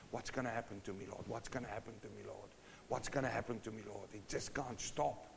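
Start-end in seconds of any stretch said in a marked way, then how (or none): 0.1-4.1 soft, worried plea to the Lord; fretful prayer. Acted. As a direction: murmur it like a man who can't sleep. Sleepless David praying in anguish.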